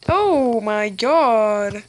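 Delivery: slow, loud stunned exclamation; drawn-out shocked oh my god. Real, not acted. Kid spotting a huge player, stunned and slow. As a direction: stretch it out in shock.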